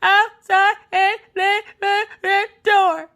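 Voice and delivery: slow, high mock bawling, word by word; upset crying child. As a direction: heave out one word per sobbing breath. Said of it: "they can't get more than one word".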